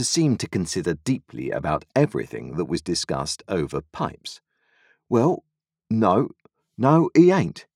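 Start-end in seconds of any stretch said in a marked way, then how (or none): 5.1-7.7 meditative Joe. A narrator's reading. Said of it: "said Joe meditatively".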